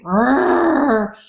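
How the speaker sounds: high, rough imitated growl; imitated bull growl. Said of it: "like, growling".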